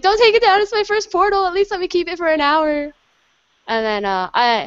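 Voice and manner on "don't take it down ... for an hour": pleading. Retold or imitated. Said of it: "I was like".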